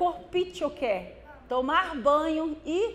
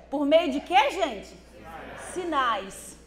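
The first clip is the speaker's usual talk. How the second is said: ordinary talk, nothing marked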